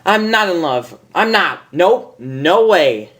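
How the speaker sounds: high, firm insistence; stubborn denial. Acted. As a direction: dig in and refuse.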